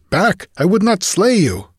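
high, urgent warning cry; strident, desperate urgency. A narrator's reading. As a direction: plead stridently with an attacker to back off. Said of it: "His voice was strident with desperate urgency".

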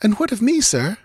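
high, pleading heroine; woman's questioning voice. A narrator's reading. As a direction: ask as an anxious young woman. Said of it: "she asked".

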